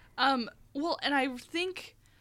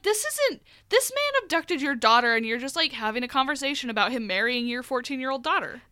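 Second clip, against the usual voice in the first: mock outrage at the mother; outraged disbelief. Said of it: "And I'm like".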